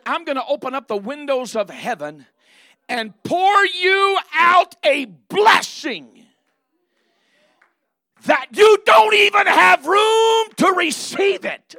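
rising from loud to a high, slow, shouted cry; building to a shout. Acted. Preacher crying out God's blessing to the church, building to a shout. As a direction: climb until you're shouting it.

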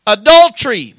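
loud, emphatic pulpit delivery; forceful preacher driving the point to the congregation; full-force preaching. Real, not acted. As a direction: hammer each named sin home. Preaches on the named sins.

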